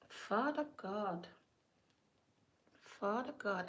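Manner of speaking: soft, drawn-out murmured invocation; hushed prayer. Real, not acted. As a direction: breathe it quietly, overwhelmed. Overcome, calling on God.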